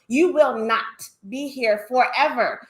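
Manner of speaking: ordinary talk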